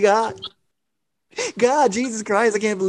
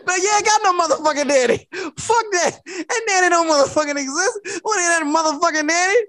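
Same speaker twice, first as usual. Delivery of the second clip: mock angry mother; 10 semitones higher than usual. Mother snapping at child.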